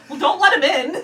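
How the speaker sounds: quoting cartoon line. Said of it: "purely quoting the show".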